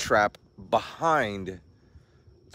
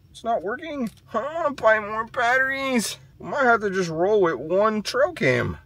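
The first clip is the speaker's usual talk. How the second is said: high, louder frustrated whine; exasperated whine; 6 dB louder than usual. Real, not acted. Owner fighting a dead trail cam, high exasperated whine. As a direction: complain in a high, fed-up voice.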